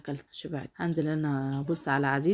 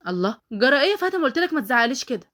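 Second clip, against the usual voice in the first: higher, louder indignant objection; affronted, raised voice; indignant protest; 5 semitones higher, 6 dB louder than usual. Acted. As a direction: push back at being scolded. Cousin protests being yelled at.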